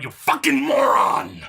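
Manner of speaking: normal talking voice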